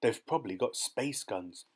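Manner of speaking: usual speaking voice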